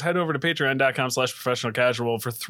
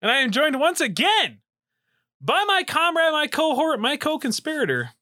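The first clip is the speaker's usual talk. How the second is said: ringmaster; 12 semitones higher than usual. Acted. Introducing co-host.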